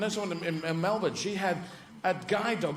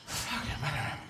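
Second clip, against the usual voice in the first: softer, sullen post-race cursing; disgruntled greyhound; 2 semitones lower, 6 dB quieter than usual. Acted. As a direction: grumble it under your breath. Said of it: "'cause they'll be going".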